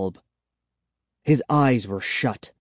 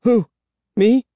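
high, mildly choked question; a touch of choked-up nerves, pitched high; 10 semitones higher, 5 dB louder than usual. A narrator's reading. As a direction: lightly suggest words catching in the throat. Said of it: "I choked out".

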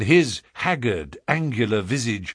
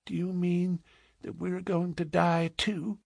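put-on small high child voice, hushed; timid question after crying; soft, high little girl holding back tears; checking sobs; 8 semitones higher, 8 dB quieter than usual. A narrator's reading. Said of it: "checking her sobs".

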